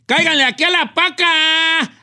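calling out like a tianguis vendor; market vendor shout. Pretend. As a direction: hawk the secondhand clothes. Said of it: "es que así gritan allá".

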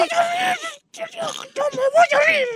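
very high, long-drawn strained squeal; helpless stifled laughter. Real, not acted. Host told to control himself, squealing through held-in laughter. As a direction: stretch it into a squeal while fighting laughter. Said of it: "gobiérnese".

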